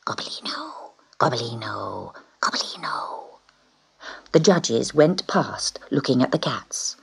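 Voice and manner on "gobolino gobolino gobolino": very soft hissed name; hissing whisper. Acted. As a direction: hiss his name round the cages. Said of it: "a kind of hiss went round the cages".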